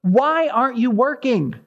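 high-pitched, pleading lament; crying out to God. Acted. Habakkuk laments to a silent God, crying out. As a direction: cry out in complaint, voice pitched well up. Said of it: "Habakkuk is crying out to God".

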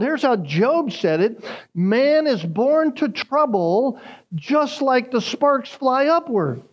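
fervent sermon delivery; preaching. Acted. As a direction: preach it out to the congregation with rising force. Preaching at full force.

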